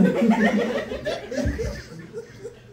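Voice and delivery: high rising laugh. Real, not acted. Laughing at own story.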